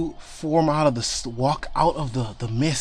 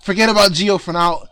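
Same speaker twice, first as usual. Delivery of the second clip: louder, higher urgent plea; pleading shout; 5 semitones higher, 8 dB louder than usual. Acted. Game master voicing Amelia begging Kai to help Santa, loud shout. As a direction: call for help across the battlefield. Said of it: "she's screaming out like".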